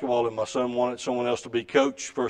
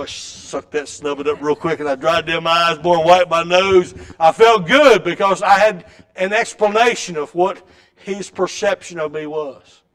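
ordinary delivery

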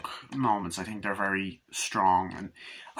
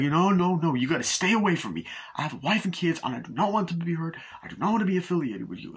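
higher, insistent acted protest, speeding up; agitated refusal; 7 semitones higher, faster than usual. Family man warns friend to stay away, agitated imitation. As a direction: push him away in a rush, imitating the film character. Acting Keith David refusing the hero.

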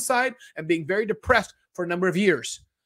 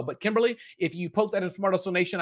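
ordinary delivery

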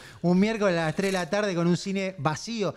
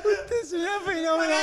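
higher imitation of the apology; mock Toscano; 11 semitones higher than usual. Friend mimicking Toscano to the lady, higher. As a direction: mimic the line, pitched up.

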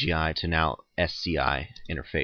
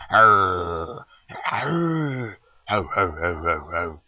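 pirate voice; 5 dB louder, much slower than usual. Pretend. Laughing at Roger's pose.

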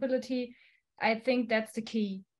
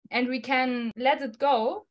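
normal talking voice

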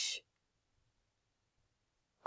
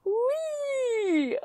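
long, high playful squeal; high whee; 10 semitones higher than usual. Real, not acted. Gamer to herself as the character flies, high whee. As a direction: draw out a high "whee" that slides down. Gamer cheering a fall in game.

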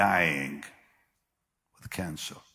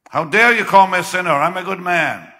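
loud, fast, high indignant outburst; uncle's furious retort; angry uncle quote; 9 semitones higher, 12 dB louder than usual. Retold or imitated. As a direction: bark it like an offended uncle. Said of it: "he got angry".

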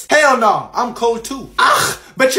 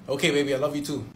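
tender; 6 semitones lower, 9 dB quieter, much faster than usual. Acted. Soft tender reply.

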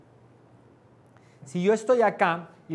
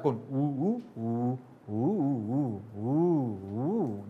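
melodious romantic grunts; 4 semitones lower, much slower than usual. Shown as a demonstration. Teacher shows a romantic message without words. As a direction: coo it lovingly. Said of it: "melodiosa y romántica".

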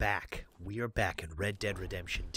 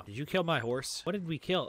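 ordinary talk

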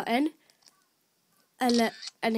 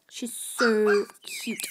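drawn-out coo; 2 semitones lower, much slower than usual. Real, not acted. Cooing over the puppy.

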